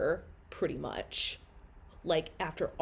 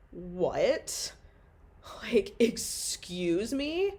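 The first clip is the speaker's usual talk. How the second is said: stunned disbelief; 2 semitones higher, much slower than usual. Retold or imitated. Told she is far along.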